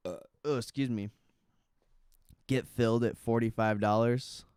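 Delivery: talking through hiccups; words broken by hiccups. Real, not acted. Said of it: "Got the hiccups".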